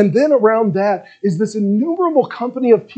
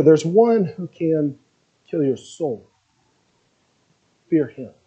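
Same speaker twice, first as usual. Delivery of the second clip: much softer, lower, measured warning; gentle and calm; 4 semitones lower, 3 dB quieter than usual. Acted. Jesus telling his friends whom to fear, soft and calm. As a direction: warn them quietly and kindly. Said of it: "a gentle and calm way".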